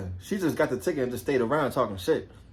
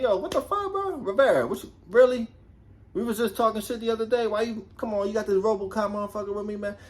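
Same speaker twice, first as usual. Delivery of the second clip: imitating the partner's protest; 7 semitones higher than usual. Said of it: "they're gonna be like".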